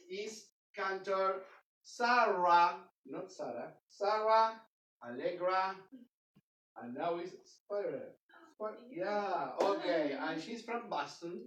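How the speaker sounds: normal talking voice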